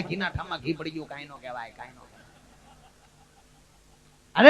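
mock feeble patient voice; hushed weak patient. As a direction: mumble it away like a beaten man hiding the truth. Injured friend hushing it up.

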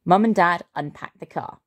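usual speaking voice

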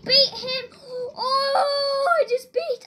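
high excited cry; excited squeal. Real, not acted. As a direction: squeal with delight. Excited at beating player.

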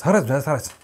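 mock unintelligible gabble; imitating someone impossible to understand; garbled accent imitation. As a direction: run the words into one incomprehensible burst. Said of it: "someone's having a stroke".

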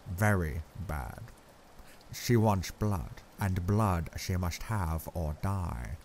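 put-on kindly accented old professor, explaining; Van Helsing kindly. A narrator's reading. Professor kindly explaining the transfusion to bewildered Arthur. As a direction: gently explain in a foreign lilt. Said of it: "explaining in a kindly way".

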